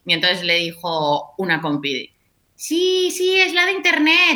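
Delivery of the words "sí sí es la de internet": coworker voice. Retold or imitated. Quoting a coworker.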